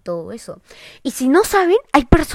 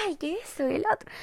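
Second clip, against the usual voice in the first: quick, higher mock of a teasing friend; 7 semitones higher, 9 dB quieter, much faster than usual. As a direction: lightly mimic a scoffing friend. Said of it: "sus amigas le decían".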